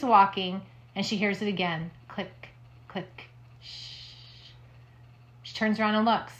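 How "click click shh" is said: soft unvoiced clicks and a long shh; spooky whispered clicks. A narrator's reading. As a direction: whisper the creepy sound like a campfire tale. Scary sound in ghost story.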